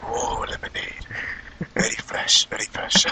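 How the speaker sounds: imitating the waiter in a slow, soft whisper. Host mimics the waiter praising the lemonade, slow hushed whisper. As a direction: hush it like a secretive waiter, slowly. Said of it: "I started imitating him".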